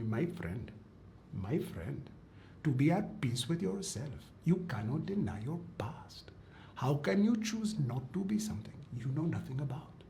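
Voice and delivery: put-on soft, measured Indian-accented monk; thick Indian accent. Monk gently counselling a young seeker, thick Indian accent. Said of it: "with the thickest Indian accent".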